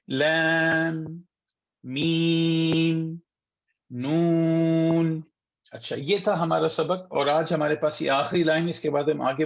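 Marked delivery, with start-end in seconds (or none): 0.1-5.2 slow, sustained monotone chant; measured Qaida drill for repeating; letter-by-letter recitation. Shown as a demonstration. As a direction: hold each Arabic letter long on one level note. Recites the Arabic letters for students.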